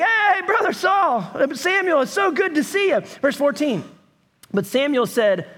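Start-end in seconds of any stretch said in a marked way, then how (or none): 0.0-3.0 lightly hammed-up fake friendliness, higher; mock cheerful greeting. Pastor mimics Samuel faking warmth toward Saul, mildly higher. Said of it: "He's like".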